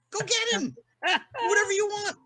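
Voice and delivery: high, far-off goading voice; Richard egging him on. Retold or imitated. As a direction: push him on stage in a high mocking voice. Richard urges him onto stage.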